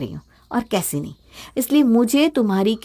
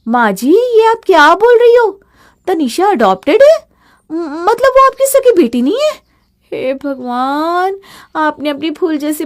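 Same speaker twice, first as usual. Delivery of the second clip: much higher and louder, hammed-up disbelief; mock shock; 6 semitones higher, 5 dB louder than usual. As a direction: fake astonishment for effect. Feigned shock at overheard secret.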